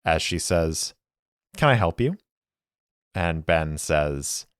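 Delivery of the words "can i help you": false smile. Acted. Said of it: "behind a mask and a smile".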